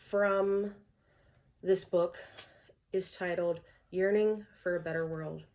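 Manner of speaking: normal talking voice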